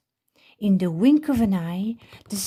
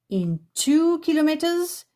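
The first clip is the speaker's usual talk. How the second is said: high, bossy navigation voice; a prim satnav giving orders; bossy sat nav lady; 8 semitones higher than usual. A narrator's reading. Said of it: "in a bossy lady's voice".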